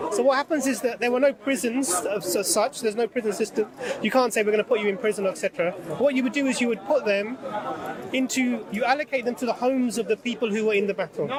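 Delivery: normal talking voice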